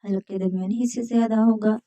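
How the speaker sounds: usual speaking voice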